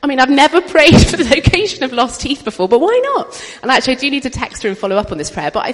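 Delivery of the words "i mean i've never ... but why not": louder, higher, laughing through it; words pushed out between laughs. Real, not acted. Telling the congregation about lost teeth, laughing loudly.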